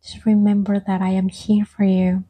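ordinary delivery

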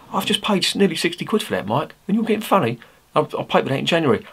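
high-pitched, indignant self-quote; indignant retort. Retold or imitated. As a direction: replay your outraged thought, pitched well up. Indignant inner rant at manufacturer.

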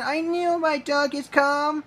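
high-pitched triumphant cheer. A narrator's reading. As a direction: exclaim in a very high, excited voice. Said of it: "cheered Ryan".

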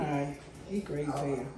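low, quiet muttered verdict; grudging aside. Real, not acted. Man giving his wife his verdict on the visitor, muttering. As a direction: drop it right down under the breath.

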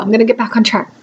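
ordinary delivery

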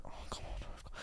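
whispered groan of disbelief; barely audible, under the breath; exasperated whisper. Retold or imitated. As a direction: whisper it to yourself, fed up. His silent reaction, acted.

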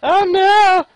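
high melodramatic wail; dramatic cry. Pretend. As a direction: ham up a tragic cry. Mock drama in game.

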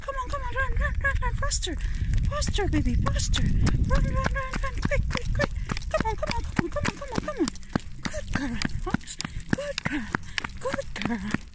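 very high, eager urging to run; excited high cheering. Real, not acted.

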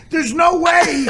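high, insistent shout; emphatic shout. Real, not acted. Arguing that the policy can't work, emphatic shout. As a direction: call it out as flat refusal.

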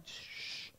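long, soft shush; hushing shh. Acted. Followers silencing a shouting blind man, hushing. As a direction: shush someone who is making a scene.

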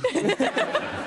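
a squeaky high laugh; shrieking laugh. Real, not acted. Host laughs at contestant.

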